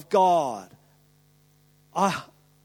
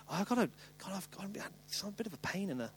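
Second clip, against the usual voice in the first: very soft, fretting mumble; mock anxious murmur; 5 semitones lower, 13 dB quieter, faster than usual. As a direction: fret under your breath. Imitating hypochondriac worry.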